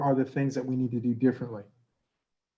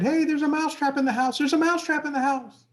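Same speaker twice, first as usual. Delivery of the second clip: high, frantic little mouse cry; panicked mouse yell; 14 semitones higher, 3 dB louder than usual. Acted. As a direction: shout it like a terrified tiny mouse. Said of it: "he said".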